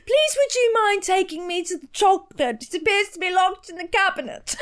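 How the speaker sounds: high, loud, prim British mimicry; mock a friend's earnest, over-polite British; exaggerated British accent. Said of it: "in an exaggerated British accent".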